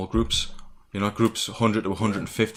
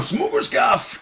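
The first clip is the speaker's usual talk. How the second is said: coughing fit; much slower than usual. Real, not acted. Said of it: "He's coughing".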